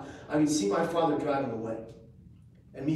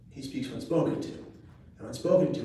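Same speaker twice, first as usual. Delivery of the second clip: usual speaking voice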